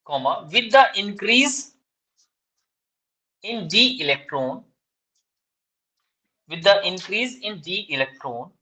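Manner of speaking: slow, deliberate dictation, each phrase spaced out. Shown as a demonstration. Teacher dictating exam answer to students, slow dictation. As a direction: read out notes word by word for students to copy. Redictates after student missed part.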